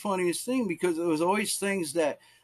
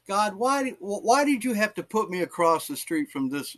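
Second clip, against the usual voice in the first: pleading, put-upon protest to God; mock complaint; 6 semitones higher than usual. Evangelist asking God about his odd neighbour, mildly exasperated. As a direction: question God in acted dismay.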